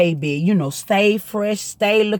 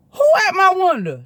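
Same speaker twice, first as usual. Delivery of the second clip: much higher, alarmed; shocked question; 10 semitones higher than usual. Retold or imitated. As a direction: react in disbelief. Shocked by peeping Tom news.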